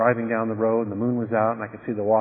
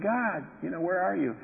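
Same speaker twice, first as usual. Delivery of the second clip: emotional plea; 7 semitones higher, 4 dB quieter than usual. Retold or imitated. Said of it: "I was getting kind of emotional".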